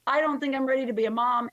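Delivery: high, tearful retelling of her daughter. Retold or imitated. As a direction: let the voice climb as she breaks down. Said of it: "she was just crying".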